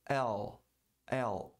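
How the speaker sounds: English L with tongue pressed to the palate; demonstrating English L. Shown as a demonstration. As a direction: press the tongue up and cut the sound. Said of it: "A pronúncia do L é".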